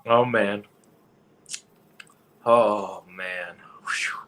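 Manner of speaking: ordinary talk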